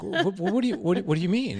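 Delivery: a wife caught off guard, stumbling; imitating a wife's flustered confusion; puzzled wife. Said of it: "And she's like".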